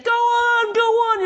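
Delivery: high, urging instructor voice, calling out; pushy instructor. Retold or imitated. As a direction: egg the student on from the cliff edge. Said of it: "your instructor's over there saying".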